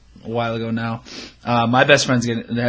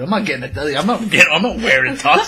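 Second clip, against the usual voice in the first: rising, louder mock protest; indignant refusal; 5 semitones higher, 3 dB louder than usual. Telling the co-host how he refused the tux, indignant. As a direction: build an incredulous objection toward a laugh.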